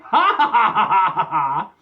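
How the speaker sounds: loud burst of ha-ha laughter. Real, not acted.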